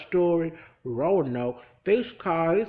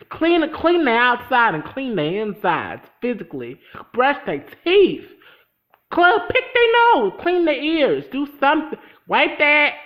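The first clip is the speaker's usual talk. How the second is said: loud, higher insistent orders; fired-up rant, slowing on the orders; loud exasperated rant; 4 semitones higher, 8 dB louder than usual. Real, not acted. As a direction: bark the cleaning orders. Ranting advice about the boys.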